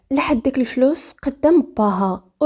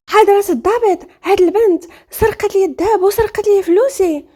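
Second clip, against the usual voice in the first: high, raised voice of complaint; agitated accusation; 7 semitones higher than usual. Acted. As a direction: act out an urgent appeal to an officer. Acted accusation made to police officer. (شكوى منفعلة بصوت عالٍ)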